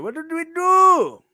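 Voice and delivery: high, slow, stretched silly voice; put-on voice. Shown as a demonstration. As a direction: pitch it up and drag it out. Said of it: "repeated in a different voice".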